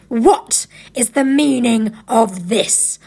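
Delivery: slow, deliberate demand from a witch; stern witch, every word weighted; imperious witch. A narrator's reading. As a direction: draw it out as a cold interrogation. Witch confronts the feasting animals.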